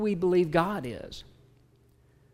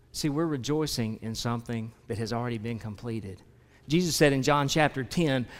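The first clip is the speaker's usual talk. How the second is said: normal talking voice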